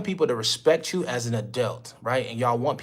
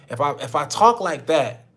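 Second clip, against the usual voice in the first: ordinary delivery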